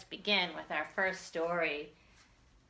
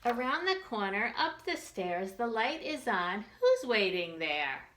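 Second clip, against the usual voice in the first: put-on reading to children; 3 semitones higher than usual. Teacher reads picture book to children.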